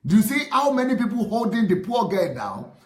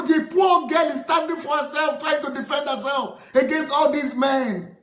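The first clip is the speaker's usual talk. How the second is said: furious shout; 5 semitones higher than usual. Real, not acted. Vlogger shouting at pastors who beat a girl, furious shout. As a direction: yell it out in real outrage at the men.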